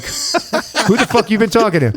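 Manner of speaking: high-pitched suspicious retort; incredulous, pitched well up; mock indignation. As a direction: act out a comically affronted comeback. Retold indignant reply on the phone.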